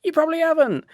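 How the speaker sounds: high, squeaky put-on voice; nagging inner critic. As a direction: chide in a silly high voice. Inner voice answers him back.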